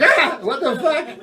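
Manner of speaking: high yelp of fake fright; hammed-up startled shriek; mock startled yelp. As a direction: jump like a ghost just spooked you. Startled by a ghost.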